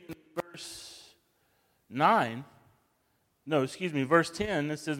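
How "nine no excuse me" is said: ordinary talk, nothing marked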